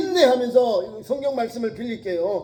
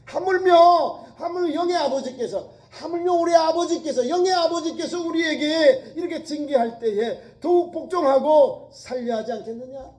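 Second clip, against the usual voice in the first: fervent preaching with laughter; 6 semitones higher, 4 dB louder than usual. Real, not acted. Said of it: "preaching climax with laughing".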